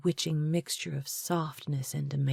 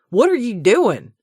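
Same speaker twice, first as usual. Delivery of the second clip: much higher and louder outburst; shocked raised voice; 7 semitones higher, 12 dB louder than usual. A narrator's reading. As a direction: cry out in stunned protest. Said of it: "his voice rising".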